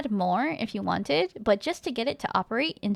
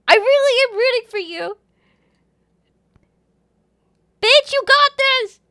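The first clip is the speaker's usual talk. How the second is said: high, loud, fierce cheering; shouted encouragement; 12 semitones higher, 10 dB louder than usual. Real, not acted. Streamer roots for a chatter, cheering loudly. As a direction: yell it like a hype friend.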